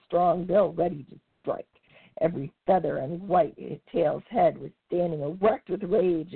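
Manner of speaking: usual speaking voice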